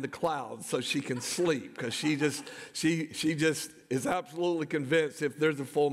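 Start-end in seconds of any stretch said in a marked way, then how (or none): none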